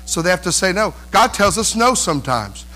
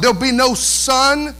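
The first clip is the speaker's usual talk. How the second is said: usual speaking voice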